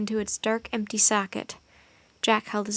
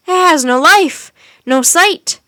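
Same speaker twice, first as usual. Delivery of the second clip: furious cry; 6 semitones higher, 11 dB louder, slower than usual. A narrator's reading. Wizard cries out at Jack and Annie in fury. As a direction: cry it out much higher and louder. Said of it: "cried the wizard".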